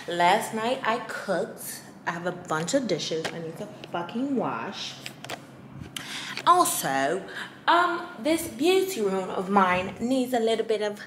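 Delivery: put-on British accent, lightly done; playing a posh Londoner in a British accent. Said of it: "I like me better with the British accent".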